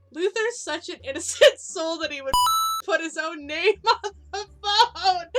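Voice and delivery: laughing through the words; cracking up mid-sentence. Real, not acted. Laughing at funny memory.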